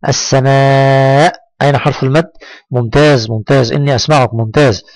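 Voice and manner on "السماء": السماء with a very long aa, lifting at the end; drawn-out madd. Shown as a demonstration. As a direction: hold the aa of السماء steadily. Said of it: "قل معي". (مدّ الألف طويلًا في السماء)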